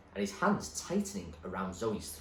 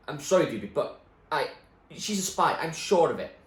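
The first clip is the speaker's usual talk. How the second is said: higher, louder, desperate excuse; pleading thug; 7 semitones higher, 8 dB louder than usual. Acted. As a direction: beg your boss to believe you. Spat pleads to Doobie.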